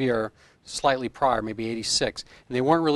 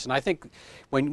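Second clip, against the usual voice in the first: ordinary talk, nothing marked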